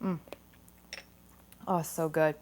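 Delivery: savouring a taste off the spoon; soft tasting hum then praise. Real, not acted. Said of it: "Give this a quick taste".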